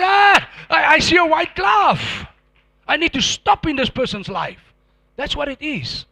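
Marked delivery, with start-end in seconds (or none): 0.0-4.6 frightened Satan. Acted. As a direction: play Satan recoiling in loud dread. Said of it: "he's afraid of the one".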